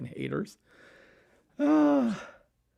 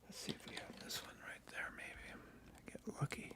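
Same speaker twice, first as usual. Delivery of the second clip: whispering; 23 dB quieter than usual. Real, not acted. Whispered while searching.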